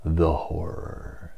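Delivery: slow, hushed and drawn out; the horror, the horror, softly and slowly; dying whisper of horror. Retold or imitated. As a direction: breathe it like a dying man. Kurtz's famous dying line.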